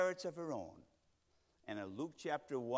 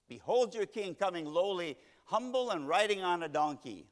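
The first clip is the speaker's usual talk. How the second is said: proclaiming scripture; 5 semitones higher, 6 dB louder than usual. Acted. Reading scripture with emphasis.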